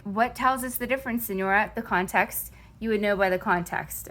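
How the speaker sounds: usual speaking voice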